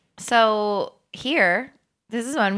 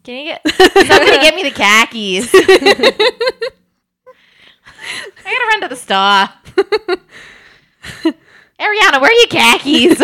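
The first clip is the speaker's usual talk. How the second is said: loud, higher hammed-up Boston accent; 9 semitones higher, 10 dB louder than usual. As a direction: shout for the khakis like a Bostonian. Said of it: "in a Boston accent".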